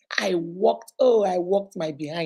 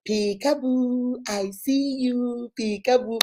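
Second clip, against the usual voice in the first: slow sing-song toy chant; drawn-out, like the toy repeating itself; 2 semitones higher, slower than usual. Retold or imitated. Mother imitating the peekaboo toy again, slow sing-song. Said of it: "the thing will say".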